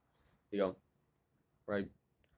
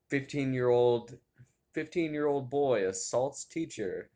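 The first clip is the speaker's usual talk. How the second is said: slow, louder newsreader announcement; mock news headline; 3 semitones higher, 5 dB louder than usual. Pretend news report about the assault, mock announcer. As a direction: read it like a grave headline.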